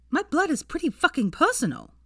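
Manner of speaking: angry snap. Acted. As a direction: bite back hard on blood. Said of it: "she snapped".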